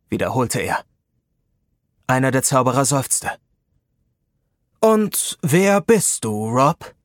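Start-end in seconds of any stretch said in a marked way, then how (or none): 4.8-6.9 wizard leader asks. A narrator's reading. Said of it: "wollte der Anführer wissen".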